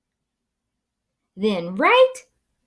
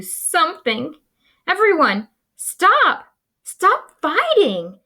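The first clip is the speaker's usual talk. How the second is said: high, louder shout to the crowd; shouting stop; 9 semitones higher, 5 dB louder than usual. Acted. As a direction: yell at them to stop. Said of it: "moaned Pipsqueak".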